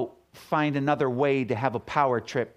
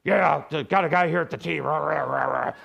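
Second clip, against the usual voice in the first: ranger on radio; 3 semitones higher, 3 dB louder than usual. Retold or imitated. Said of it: "About two seconds later".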